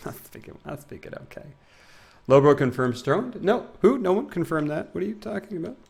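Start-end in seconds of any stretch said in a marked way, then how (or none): 0.1-1.5 whispered, laughing admission; whispered aside. Real, not acted. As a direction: drop to a whisper, sheepish. Laughing self-correction.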